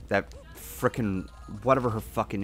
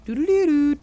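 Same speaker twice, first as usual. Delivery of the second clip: high sung jingle; 5 dB louder than usual. Retold or imitated. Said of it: "I could just imagine that sound".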